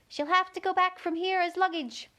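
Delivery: a pompous beetle. A narrator's reading. Beetle speaks in turn.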